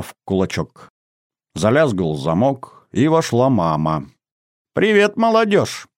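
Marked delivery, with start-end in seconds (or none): 4.7-5.9 high, merry greeting; cheerful. A narrator's reading. Mother greeting the young guests, cheerful. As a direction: greet them gaily, pitch lifted high. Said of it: "весело сказала она".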